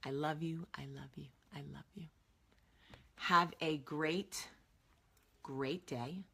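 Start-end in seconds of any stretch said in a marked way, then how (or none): 0.0-2.1 whispered love. Real, not acted. Affectionate sign-off.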